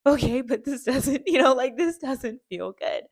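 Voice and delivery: half-laughing, half-pleading; laughing protest. Retold or imitated. Answering the encouraging women, laughing protest.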